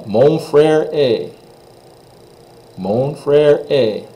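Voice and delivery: Mon frère est said slowly, stress on frère; slow teacher demo; French pronunciation. Shown as a demonstration. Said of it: "Next one".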